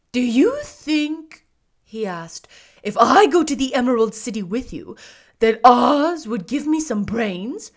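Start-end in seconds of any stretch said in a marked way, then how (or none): 0.1-1.5 slow, high, loud straw man; husky scarecrow. A narrator's reading. Scarecrow asking the girl for advice, husky. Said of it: "in a rather husky voice". 2.8-7.7 loud, hopeful straw man; husky scarecrow. A narrator's reading. Scarecrow hoping Oz will give brains, husky. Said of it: "in a rather husky voice".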